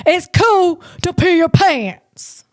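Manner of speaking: a loose try at a dopey Sandler drawl; mock comedian voice, not quite there; Adam Sandler impression. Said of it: "That is from the great Adam Sandler".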